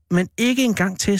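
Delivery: high, pleading cry; cried out. A narrator's reading. Boy crying out to his brother not to leave without him, high and pleading. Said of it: "skreg jeg".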